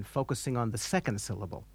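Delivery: ordinary talk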